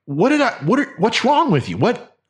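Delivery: high-pitched, stumbling protest; retold indignant bafflement. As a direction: reenact being taken aback and affronted. Acts out his baffled retort.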